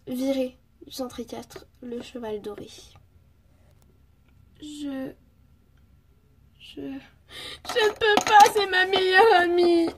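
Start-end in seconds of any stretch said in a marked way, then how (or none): 4.6-9.9 drawn-out, high tearful protest; slow, high crying whine; tearful wail. Acted. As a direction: wail it through put-on sobs. Distressed Zoé refuses to accuse friend.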